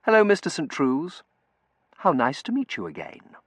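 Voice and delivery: composed, cultured greeting; refined, lightly lifted voice. A narrator's reading. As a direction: greet him with well-bred politeness. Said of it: "Her voice was quiet and cultured".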